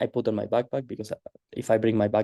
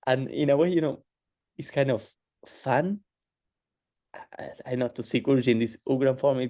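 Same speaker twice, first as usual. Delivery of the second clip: ordinary delivery, nothing marked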